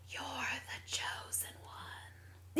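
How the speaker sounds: breathy, slow, eerie whisper; creeping nightmare whisper; creepy whisper. Retold or imitated. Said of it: "How'd they say it in the audiobook?".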